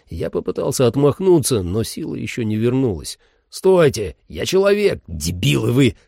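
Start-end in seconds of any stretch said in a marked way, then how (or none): 3.5-5.9 hoarse. Acted. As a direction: rasp it out half-drowned. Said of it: "прохрипел я".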